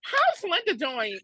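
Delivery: high, slow asking aunt; aunt asking high and drawn out; curious aunt. Retold or imitated. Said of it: "she'll be like".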